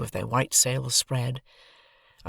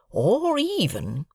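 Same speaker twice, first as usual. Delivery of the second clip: Joe drawing out; 11 semitones higher, much slower than usual. A narrator's reading. Joe harping on.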